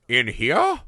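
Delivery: rising, appalled "In here?" with a curled lip; mock disgusted question. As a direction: ask it like she smelled a diaper. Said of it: "Like, with a question".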